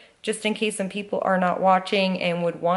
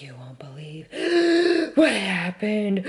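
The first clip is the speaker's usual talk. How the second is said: breathing heavily. Retold or imitated. Winded wife collapsed on the bed telling her husband, gasping. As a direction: gasp for air between words, barely voiced. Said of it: "I'm breathing so heavy".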